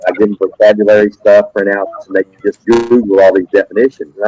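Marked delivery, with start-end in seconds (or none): none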